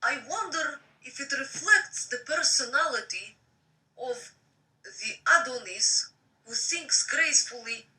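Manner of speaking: reading a novel aloud; story narration. Acted.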